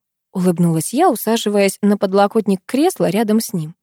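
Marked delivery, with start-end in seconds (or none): none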